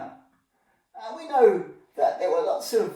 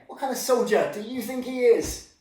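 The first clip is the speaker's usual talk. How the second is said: usual speaking voice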